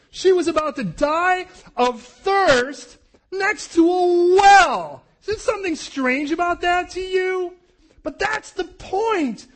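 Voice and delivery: high, loud, slow emphatic preaching; preaching at full force. Real, not acted. Preacher marveling at Hagar by the well, loud slow preaching. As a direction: drive the point home, dragging it out.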